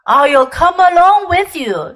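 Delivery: hearty cabby. A narrator's reading. Cabby volunteering to go along, hearty. Cabby figure in narrated story.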